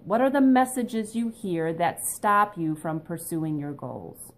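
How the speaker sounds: ordinary talk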